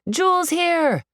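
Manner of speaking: high, excited call; fans shouting. A narrator's reading. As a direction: shout the news across the room. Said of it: "they shout".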